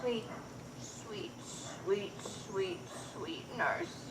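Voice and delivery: soft, whispered coaxing; whispering. Acted. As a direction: whisper sweetly to win her over. Juliet coaxes the nurse.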